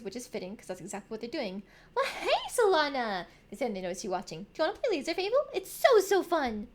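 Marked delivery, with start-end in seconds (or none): 1.9-3.3 higher, louder bubbly hello; excited greeting. Acted. Voicing child greeting player. 4.5-6.7 put-on high, loud, eager kid voice; gush, stretching out the last words; excited invitation. Voicing excited child Nomi.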